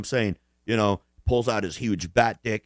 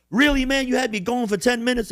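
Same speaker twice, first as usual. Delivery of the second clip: high-pitched, loud disbelief; mock exasperation; 13 semitones higher, 4 dB louder than usual. Friend realising he was never understood, imitated in high exasperation.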